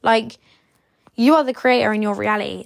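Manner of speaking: usual speaking voice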